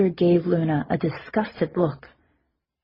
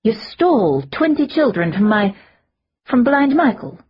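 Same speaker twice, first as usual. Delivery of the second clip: rising in pitch and louder to a shocked high cry; shocked disbelief; the last words jump high in pure astonishment; stunned disbelief; 5 semitones higher, 5 dB louder than usual. A narrator's reading. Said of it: "eyes going suddenly wide".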